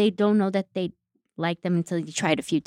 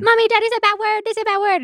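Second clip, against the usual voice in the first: put-on toddler voice; 8 dB louder, faster than usual. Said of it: "my three-year-old's like".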